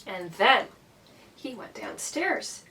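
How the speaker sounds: ordinary delivery, nothing marked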